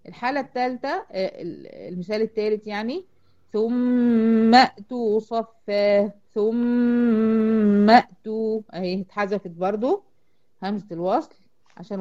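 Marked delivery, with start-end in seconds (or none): 3.5-6.1 ثم with a very long humming doubled mim, straight into the hamza of ائتوا; joined recitation. Shown as a demonstration. Teacher recites the third example to show linking, slow chant. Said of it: "دخلت من الميم المشددة على همزة القطع". 6.3-8.6 ثم held very long on the mim, then clean hamza into ائتوا; joined recitation. Shown as a demonstration. Teacher repeats the example for the dropped hamza, slow chant. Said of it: "دخلت من الميم المشددة على همزة القطع".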